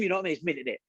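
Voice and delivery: ordinary delivery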